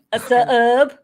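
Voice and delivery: "sub" stretched loudly into two syllables; exaggerated Southern sub. Shown as a demonstration. Host joking with quizzing friends, "sub" stretched into two syllables. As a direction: drawl "sub" as "suh-ub".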